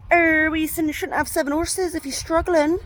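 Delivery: mock a moaning commenter in high, loud Bristolian; put-on Bristolian voice, pitched well up; high-pitched Bristolian busybody imitation; Bristolian accent. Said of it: "I've just done a Bristolian accent".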